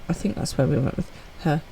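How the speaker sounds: ordinary delivery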